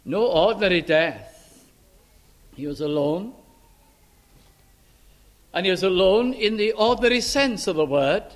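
usual speaking voice

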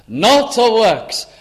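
emphatic scripture, slowed right down; slow proclaimed verse. Acted. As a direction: lean on each word, slowly. Preacher repeats verse again.

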